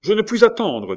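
high, loud impatient call; called from the corridor. A narrator's reading. Visitor forcing his way in, impatient call. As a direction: call it out sharply from outside the room. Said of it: "dit une voix dans le corridor".

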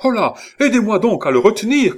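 high, loud, hurried call for help; crying out. A narrator's reading. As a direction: call urgently across the room. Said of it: "cria Henri". (appel au secours crié, pressé)

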